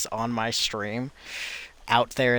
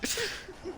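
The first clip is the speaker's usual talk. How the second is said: high burst of laughter; 11 dB quieter than usual. Real, not acted. Laughs during fake cry.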